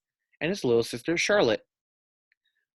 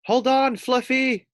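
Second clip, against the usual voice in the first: much higher, louder call across water; urgent call; 13 semitones higher, 6 dB louder, slower than usual. A narrator's reading. Wade calling to the drifting pig, high and loud. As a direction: shout it out over the lake.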